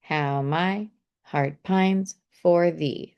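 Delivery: slow, syllables spaced out to count; slow, deliberate syllable reading; counting the beats. Shown as a demonstration. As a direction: read it slowly to count each syllable. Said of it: "So we got one, two, three".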